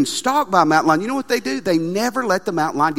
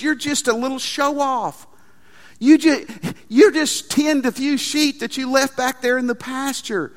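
higher, taunting put-down; mocking big brother; 6 semitones higher than usual. Older brother taunting David, mocking and higher. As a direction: sneer at the little brother. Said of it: "His brother says".

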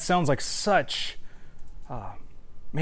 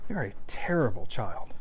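hushed, voiceless taunt; whispered accusation; 5 semitones lower, 6 dB quieter than usual. Retold or imitated. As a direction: whisper the insult like a voice in the head.

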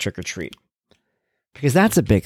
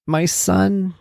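ordinary talk, nothing marked

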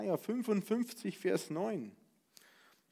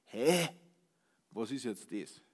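puzzled Bavarian "Was ist jetzt das?" with a broad Bavarian "das" said as "des"; Bavarian dialect; 6 semitones lower, slower than usual. Retold or imitated. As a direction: say it like a baffled Bavarian. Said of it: "wenn ich auf Bayerisch sage".

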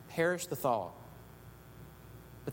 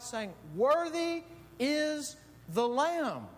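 rising proclamation; 8 semitones higher than usual. Acted. Every creature singing praise to God.

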